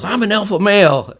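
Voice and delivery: high, loud imitated chest-thumping; mock boast. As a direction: play the tough guy bragging. Aggressive guy boasting.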